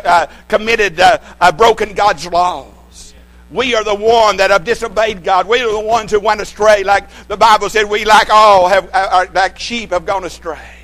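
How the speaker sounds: loud, rising preacher's cry; full-force preaching. Real, not acted. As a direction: drive it home from the pulpit.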